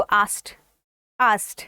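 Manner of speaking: आस्क्ड said as "ast", k silent, ending on t; correct pronunciation. Shown as a demonstration. Teacher drilling the correct आस्क्ड, k silent, t ending. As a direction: drop the k in आस्क्ड and finish with t. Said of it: "डी की जगह टी का sound दो".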